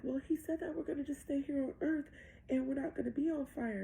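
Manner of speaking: hint of a little girl protesting; young daughter. Six-year-old repeats the devil's promise to mom, lightly voiced child. Daughter answering back in retelling.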